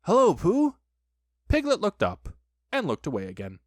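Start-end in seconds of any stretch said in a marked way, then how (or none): 0.1-0.8 high, loud hail; cheerful greeting. A narrator's reading. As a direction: call back gladly. Christopher Robin returns the shouted greeting.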